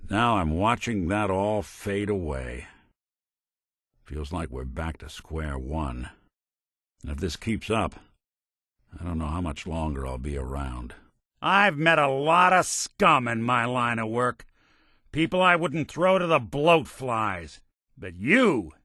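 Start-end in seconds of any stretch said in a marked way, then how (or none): 4.0-11.0 low, subdued and weary resignation; dejected. Acted. Character sadly weighs leaving partner. 11.4-18.7 raised, scornful dressing-down; disgusted anger. Acted. Character angrily condemns the partner.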